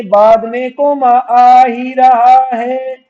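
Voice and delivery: loud, high, stretched-out chant; emphatic drilling. Shown as a demonstration. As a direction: belt the rule out slowly, syllable by syllable. Teacher hammers the rule home.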